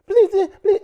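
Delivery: high, squeaky mock plea; whiny falsetto imitation; mock begging. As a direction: squeal it like a parody of the driver. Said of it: "Stop begging".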